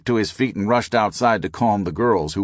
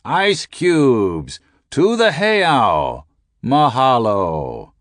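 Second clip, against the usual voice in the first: high, very slow, louder drawn-out call; monster bullhorn call; 5 semitones higher, 5 dB louder, much slower than usual. A narrator's reading. Narrator's final ice call over the bullhorn, slow and booming. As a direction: stretch every word like a booming loudspeaker. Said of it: "like the voice of some monster".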